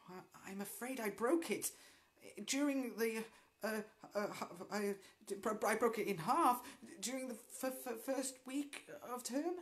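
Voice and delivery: stammering, rushed and high; nervous admission. Acted. Mildred confessing her broken broomstick to the teacher, stammering. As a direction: stumble through a guilty confession. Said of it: "admitted Mildred".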